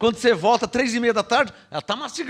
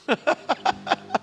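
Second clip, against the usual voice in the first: soft breathy laugh; 6 semitones higher, 5 dB quieter than usual. Real, not acted. Preacher laughs at own point.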